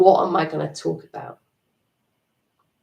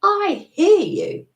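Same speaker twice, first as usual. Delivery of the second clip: much higher pitch, slow and soothing; higher, gentle acknowledgment; 12 semitones higher, 4 dB louder than usual. Shown as a demonstration. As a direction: talk kindly to your inner voice. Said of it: "you can even say".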